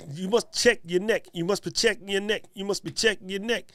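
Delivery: tossing in a hint of Staten Island; Staten Island accent. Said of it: "that was for Lemuel and his Staten Islandness".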